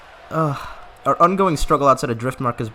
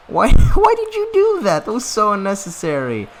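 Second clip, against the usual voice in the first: high squealing laugh through the words; laughing disbelief; 9 semitones higher, 8 dB louder than usual. Real, not acted. Player scolding the dragon, laughing in disbelief. Dragon burned own capital.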